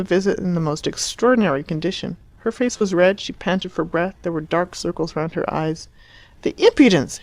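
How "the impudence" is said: high, loud indignant cry; shouted outrage. A narrator's reading. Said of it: "she shouted".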